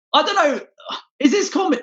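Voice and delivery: "I don't know" jumped up high and squeaky. Shown as a demonstration. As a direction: squeak the line up high, mock clueless.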